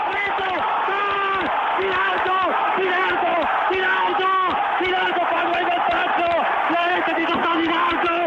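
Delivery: excited goal call; goal shout. Real, not acted. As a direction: roar the goal.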